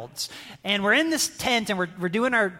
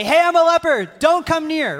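loud, high warning call; 8 semitones higher, 7 dB louder than usual. Retold or imitated. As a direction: shout it to keep people away. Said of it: "the laws requires them to say".

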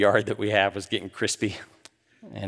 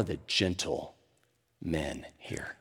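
whispered; 6 semitones lower, 8 dB quieter, much slower than usual. Acted. Preacher asks softly.

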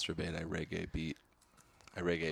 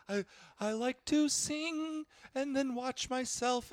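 very high, slow falsetto brag; slower than usual. Acted. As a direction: preen in a squeaky falsetto. High falsetto vain boast.

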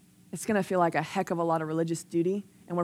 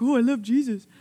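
mock gushing devotion; 3 semitones higher than usual. Mimicking showy devotion she disclaims.

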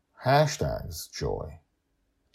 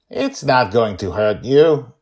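growling; 7 semitones higher, 11 dB louder than usual. A narrator's reading. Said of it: "she growls, her voice shaking".

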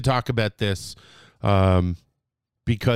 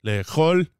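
ordinary talk

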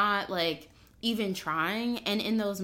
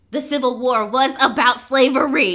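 louder, higher exasperated outburst; exasperated shout; 5 semitones higher, 11 dB louder than usual. Retold or imitated. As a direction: blurt it out, fed up.

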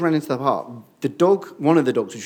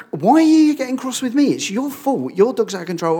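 higher and louder, indignant comeback; angry retort; 10 semitones higher, 5 dB louder than usual. Retold or imitated. Father snapping back at the dog owner, indignant. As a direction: push back hard at the man who blames you.

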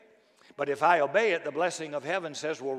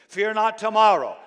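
shouted Fear not tomorrow; loud proclamation; 4 semitones higher, 9 dB louder than usual. Acted. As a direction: cry it out to the crowd. Preaching climax.

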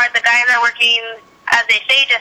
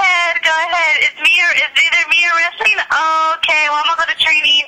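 higher, sassy telling-off; sassy mock ultimatum; 6 semitones higher than usual. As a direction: show him the door, sassy. Acting out telling a boyfriend off.

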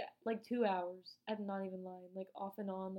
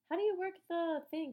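higher, louder mock-baffled question; imitated confusion; 8 semitones higher, 7 dB louder than usual. Girls in the locker room asking about the bracelet, confused. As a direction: mimic puzzled girls, pitched up and louder. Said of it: "no one understood it".

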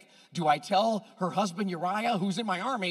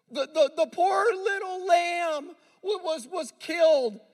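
mocking whine; 9 semitones higher than usual. Mocking David's misplaced focus.

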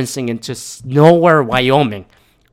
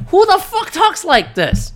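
incredulous; slower than usual. Retold or imitated. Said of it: "And I'm like".

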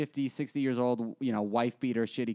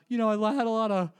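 higher, louder boast from a fire victim; lightly voiced bragging man; a fire victim bragging to the news crew; mock fire victim; 6 semitones higher, 3 dB louder than usual. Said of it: "And then he goes".

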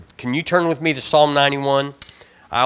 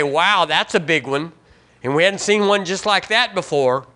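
ordinary talk

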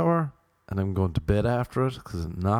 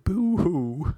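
hammed-up boo-hoo; mock boo-hoo; 8 semitones higher than usual. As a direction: fake sob, high and hammed-up. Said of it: "mock sobbing".

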